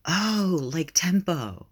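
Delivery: high, bright burst of understanding; suddenly getting it, delighted; excited realization. A narrator's reading. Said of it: "eyes light up in understanding".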